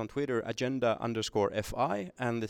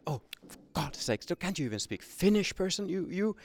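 mild mock scorn, pitched higher; mocking doctor; 7 semitones higher than usual. Retold doctor mocks a patient who cannot speak Finnish, mild mock scorn. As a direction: sneer at the patient, only lightly. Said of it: "They mocked them".

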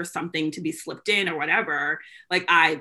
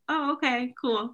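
fake-cheerful okay; 6 semitones higher than usual. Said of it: "I was like".

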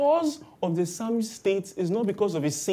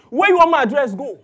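much louder and higher, fed up; exasperated retort; 9 semitones higher, 11 dB louder than usual. Retold or imitated. Squeezed bus passenger snapping at people who keep asking her to move over, angry. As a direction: throw the question back in frustration.

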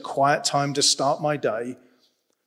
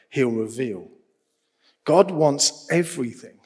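ordinary talk, nothing marked

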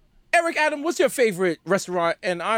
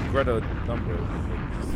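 ad tagline; 9 semitones lower, 4 dB quieter, much slower than usual. Pretend. Ad tag read.

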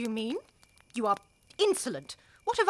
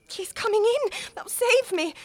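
higher, agitated relief; anxious hope; 10 semitones higher than usual. Acted. Wife hoping her husband will save her, high and agitated. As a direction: clutch at a rescue.

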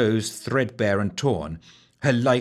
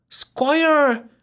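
pleading; 5 dB louder than usual. A narrator's reading. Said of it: "the wench pleaded".

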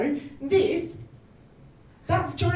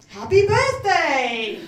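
drawn-out, louder birthday cheer; exuberant greeting; 5 dB louder, much slower than usual. Retold or imitated. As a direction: stretch it out like a party greeting. Mimes friend's cheery birthday greeting.

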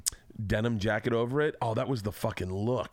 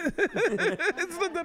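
laughing; much slower than usual. Retold or imitated. Mimicking accent, laughing.

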